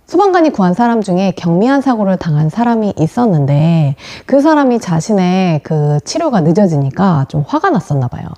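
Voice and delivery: ordinary talk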